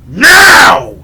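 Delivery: huge drawn-out shout; imitated shouted command. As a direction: cut him off with a roaring order. Big man cuts him off.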